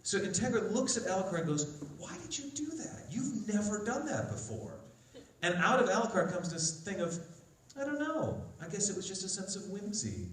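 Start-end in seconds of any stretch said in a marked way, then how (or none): 2.0-4.8 high, soft imitated woman's voice; questioning Integra. Integra questions Alucard's unusual act, high soft questioning. 7.8-10.3 high, light, whimsical reply; whimsical Alucard. Retold or imitated. Alucard answers Integra about his act, lightly whimsical. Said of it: "Whimsy? Whimsical Alucard?".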